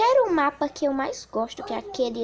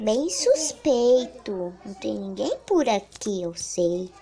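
ordinary talk

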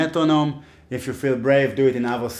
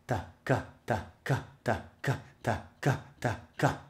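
faster syllable drill; 8 dB quieter than usual. Shown as a demonstration. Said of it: "A little bit faster".

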